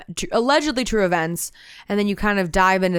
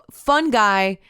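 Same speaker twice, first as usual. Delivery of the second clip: normal talking voice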